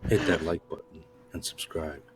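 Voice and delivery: ASMR voice. Pretend. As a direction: murmur it close and hushed. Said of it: "the A S M R voice".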